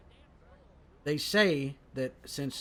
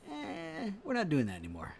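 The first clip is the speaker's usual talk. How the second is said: mock dismissive Washington; 3 semitones higher, 5 dB quieter than usual. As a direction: wave the rebels off lazily, a hint of Washington. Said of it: "He was like".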